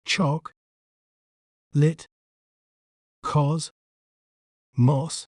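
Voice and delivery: British "Choc. Lit. Cos. Moss.", each syllable on its own; British accent, syllable by syllable. Shown as a demonstration. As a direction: break it into separate British syllables with long pauses. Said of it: "In British accent".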